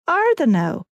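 rising, interested question; intrigued question. A narrator's reading. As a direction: perk up, suddenly curious. Bloke intrigued.

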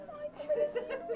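very high, soft, laughing squeal; giggly gush. Real, not acted. Squealing to viewers over the Mickey plush.

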